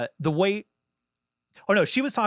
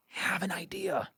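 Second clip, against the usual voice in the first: whispered point; 2 semitones higher, 7 dB quieter, slower than usual. Real, not acted. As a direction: whisper it slowly for emphasis. Key advice, dropped to a whisper.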